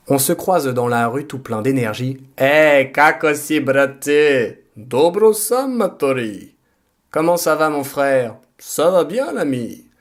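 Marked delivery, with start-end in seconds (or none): 2.4-4.6 loud call to a buddy in Serbian; energetic shouted hello; hearty street greeting. Acted. Acted street greeting between friends. 4.8-6.5 drawn-out, high-swooping reply in Serbian; playful hearty reply; exuberant greeting back. Acted. Acted reply to street greeting. 7.1-9.9 lively, higher-pitched hello between pals; playful street small talk; hearty greeting exchange. Acted. Acted French exchange of greetings.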